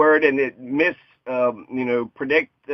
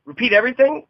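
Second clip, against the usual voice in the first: rising tune on everything; asked as a question; 7 semitones higher, faster than usual. Shown as a demonstration. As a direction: lift the end into a question. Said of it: "as a question".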